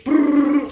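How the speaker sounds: high rolled "brrr"; drawn-out, high-pitched flurry noise; imitated fight noise. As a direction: trill out a brawl sound effect.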